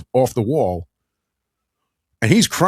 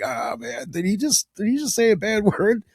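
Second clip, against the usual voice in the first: hushed, high-pitched shock with a giggle; mock scandalized; 7 semitones higher, 5 dB quieter than usual. Grown listener catching Michael cursing, mock scandalized. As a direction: softly gasp it like a shocked kid, amused.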